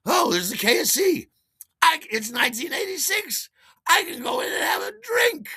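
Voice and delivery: high, loud burst of glee; gleeful whoop rising to a shout; acted excited realisation. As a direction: exclaim it like a thrilled reveller.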